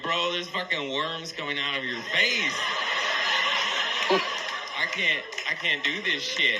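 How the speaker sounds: high, laughing panic to the cop; a tripping guy begging the ranger; panicked imagined reply. Pretend. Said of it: "I didn't say that".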